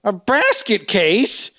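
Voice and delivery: high, drawn-out, hammed-up question; waiter impression. Waiter joking over the bread, slow high put-on voice. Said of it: "and he goes".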